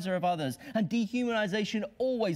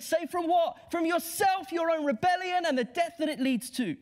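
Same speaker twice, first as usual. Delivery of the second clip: preaching climax; 9 semitones higher than usual. Real, not acted. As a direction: lift it to a ringing peak.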